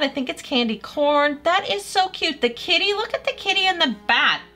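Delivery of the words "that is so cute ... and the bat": high-pitched cooing delight; gushing. Real, not acted. Vlogger spotting the kitty on her nails, gushing. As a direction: gush over it, pitched right up.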